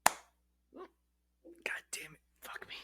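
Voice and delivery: real whispered cursing, very soft; exasperated aside. Real, not acted. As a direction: swear under your breath, slowly. Swearing aside over returning hiccups.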